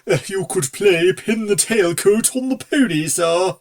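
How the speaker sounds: nervous servant offering an idea; hesitant suggestion. Acted. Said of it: "suggested Silver".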